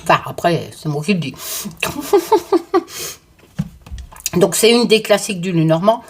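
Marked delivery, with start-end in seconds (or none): 1.4-3.1 rire aigu. Real, not acted. Rit de sa propre vantardise.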